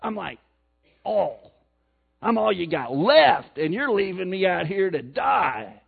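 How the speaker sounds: mock Missouri hillbilly complaint to God. Preacher voicing Elijah complaining to God, light Missouri hillbilly twang. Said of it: "I got this Missouri hillbilly thing going on".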